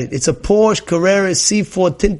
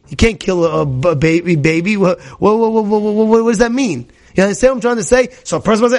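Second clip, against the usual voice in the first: ordinary talk, nothing marked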